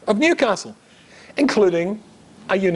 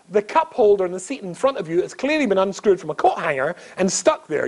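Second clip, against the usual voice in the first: ordinary talk, nothing marked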